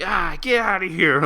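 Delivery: high, loud retold outburst; imitated loud brush-off; mock dismissive yell. Acting drunk brother brushing off cop.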